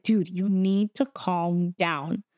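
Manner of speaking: slow firm warning. Retold or imitated. Acted security guard calming customer.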